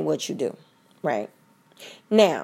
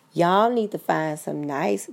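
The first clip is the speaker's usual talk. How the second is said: ordinary talk, nothing marked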